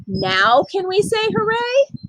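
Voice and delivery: put-on excited child pleading to cheer; eager question. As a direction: ask it like a child who can't wait.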